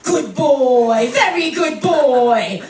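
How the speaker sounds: loud, slow, drawn-out coddling praise; gushing mock mum praise; praising a good boy. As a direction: praise a toddler like a pet, stretching each word. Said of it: "sounds a lot like".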